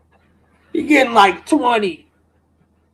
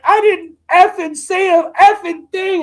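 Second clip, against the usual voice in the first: higher, louder angry snap; retold outburst; 7 semitones higher, 5 dB louder than usual. Teen snapping back at his teacher, angry shout. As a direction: let it rip like a teen snapping. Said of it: "bro, I snapped".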